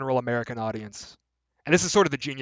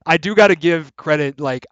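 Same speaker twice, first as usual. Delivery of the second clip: ordinary delivery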